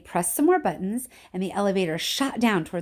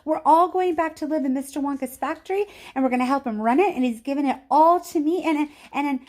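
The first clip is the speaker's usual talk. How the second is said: high, louder tumbling rush; can't get the news out fast enough; breathless excitement; 8 semitones higher, 5 dB louder than usual. A narrator's reading. Charlie telling Mum the news, breathless with excitement.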